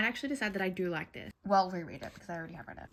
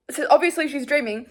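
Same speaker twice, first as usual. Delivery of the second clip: ordinary talk, nothing marked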